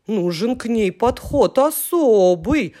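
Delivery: a lightly voiced fussy little character; hint of a put-on voice; mumbling soft sign. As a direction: grumble it like a weary sceptic. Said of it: "вдруг промямлил мягкий знак".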